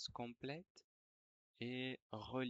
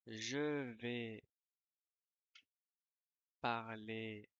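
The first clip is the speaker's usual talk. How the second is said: dictating. Shown as a demonstration. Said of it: "je vais dicter une dictée".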